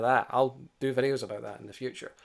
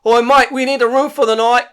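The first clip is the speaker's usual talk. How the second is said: higher, louder put-on English soldier voice; swaggering soldier; 13 semitones higher, 16 dB louder than usual. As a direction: play a cocky English soldier barging in. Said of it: "they just walked in, just like".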